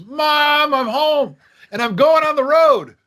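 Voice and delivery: high, loud excited announcement; excited shout. Retold or imitated. Teen shouting to mom he's going on the road, excited. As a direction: burst in the door yelling the big news.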